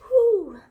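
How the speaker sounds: a sudden loud high whew of release; loud overwhelmed exhale. Real, not acted. Alone before Christmas, a loud high exhale. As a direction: blow out the feeling in one loud breath.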